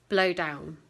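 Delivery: ordinary delivery, nothing marked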